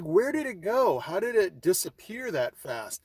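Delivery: alarmed, puzzled questions to her daughter; startled. Retold or imitated. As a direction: ask it like the snake just vanished. Snake vanished from the road.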